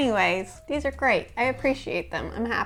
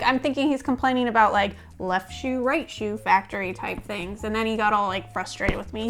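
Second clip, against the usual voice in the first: ordinary talk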